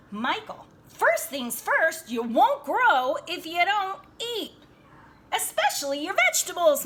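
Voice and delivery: stern mother insisting he eat; firm mom scolding. Acted. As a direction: tell him off like a mother at dinner. Mama scolding son at dinner.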